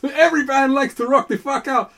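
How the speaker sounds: high, loud rocker voice; bassist's outraged rock-star protest. Retold or imitated. Bassist replies.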